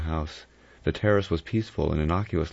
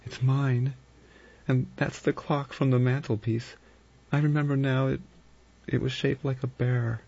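high, hushed, horrified woman; shuddering voice; 9 semitones higher than usual. A narrator's reading. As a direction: realise it with dawning dread. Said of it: "said in a low, shuddering voice".

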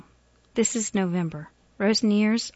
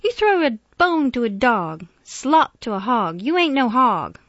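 loud, slow and high; emphatic, indignant insistence; impassioned protest; 5 semitones higher, 8 dB louder, slower than usual. A narrator's reading. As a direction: hammer it home, drawing out key words. Miss Emma argues with Jefferson.

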